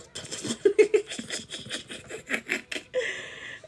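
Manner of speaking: mock spluttered speech breaking into high laughter; imitating swollen-mouth talk. Vlogger showing viewers her morning talk, spluttering and laughing. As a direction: splutter wordlessly, then crack up. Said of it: "in the mornings, I'm like".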